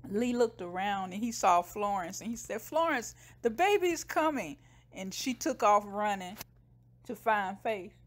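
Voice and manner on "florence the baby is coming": high, acted alarmed announcement; excited mock cry for help; urgent calling out. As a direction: call out the big news. Man calls out urgent news.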